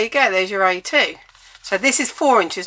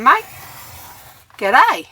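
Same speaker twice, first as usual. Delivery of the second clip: hammed-up Australian accent, pitch swooping higher and louder; bad Aussie accent; 5 semitones higher, 5 dB louder than usual. Crafter mocking own slipped accent to viewers, hammed-up Australian. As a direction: put on a jokey, badly faked Aussie accent. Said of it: "That's a really bad accent".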